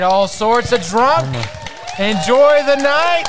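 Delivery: shouting. Real, not acted. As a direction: call it out to the crowd. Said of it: "show sign-off".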